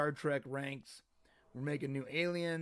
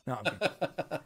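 normal talking voice

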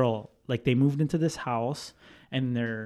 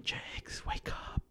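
hushed, voiceless wake-up whisper; urgent whisper; 14 dB quieter, slower than usual. Retold or imitated. Childhood friend waking sleeper at night, whispering. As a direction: whisper as if afraid to be heard.

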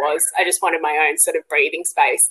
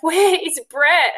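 high, slow, drawn-out demand; stretched-out, higher-pitched mock wail; urgent calling out; 10 semitones higher, 4 dB louder, much slower than usual. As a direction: re-enact a labouring call for a partner. Re-enacts labour demand for partner.

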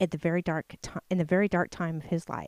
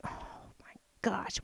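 exasperated whisper; 12 dB quieter than usual. Retold or imitated. Exasperated parent hearing kids whine.

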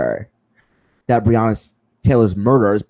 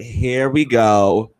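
weary eye-roll; much slower than usual. Retold or imitated. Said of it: "I was like".

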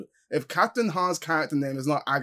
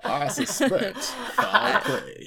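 Daniel Craig; 8 semitones higher, much slower than usual. Acted. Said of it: "Is that your Daniel Craig?".

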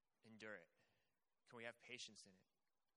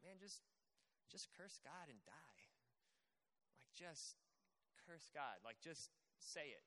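softer, coaxing mock friend; friends' advice; 3 semitones lower, 7 dB quieter than usual. Job's friends urging him to curse God, softly coaxing. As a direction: lean in and coax him quietly. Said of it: "little friends are just kinda like".

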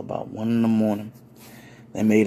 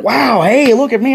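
high, loud wowed exclamation; excited guest; 10 semitones higher, 7 dB louder than usual. Retold or imitated. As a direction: call out in loud amazement. Acts out delighted guest watching dancing.

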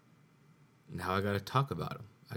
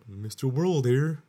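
loud, slow mock TV spokesman, pitched up; hammed-up ad mascot greeting; ad announcer voice; 6 semitones higher, 9 dB louder, slower than usual. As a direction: boom it out like a cheesy commercial intro. Mimicking the commercial's mascot line.

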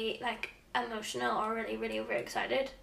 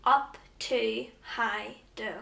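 slow, word by word; much slower than usual. Shown as a demonstration. Quizmaster repeating the phrase for the guesser, slow, word by word.

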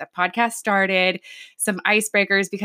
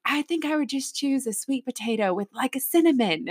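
lightly attempted Australian accent, higher pitched; 5 semitones higher, 4 dB quieter than usual. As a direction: have a rough go at an Australian accent. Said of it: "he had, like, a really cool Australian accent".

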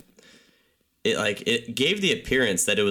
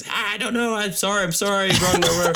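high, frantic mock yell; flustered high-pitched pleading; retold panicked yelling; 11 semitones higher than usual. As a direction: re-enact yelling an apology, pitched way up. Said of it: "I was yelling English at him".

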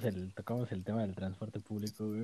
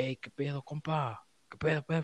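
higher, rowdy northern Mexican ranchero greeting; northern Mexican accent; 6 semitones higher than usual. Retold or imitated. Mocking how people from the north of Mexico talk, in a northern Mexican accent. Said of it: "es el acento así de".

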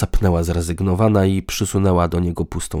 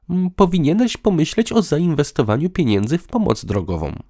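high-pitched, half-joking suggestion; half cheerful, half serious; 9 semitones higher than usual. A narrator's reading. Woman advises partner on business, half joking. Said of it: "na poły wesoło, na poły poważnie".

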